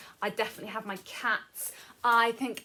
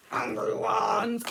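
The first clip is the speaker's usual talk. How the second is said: a slow, louder play-angry shout; mock angry shout; 7 dB louder, slower than usual. Storyteller acting out angry feelings for children, mock angry shout. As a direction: act out the angry feeling for the kids, slow and hammed-up.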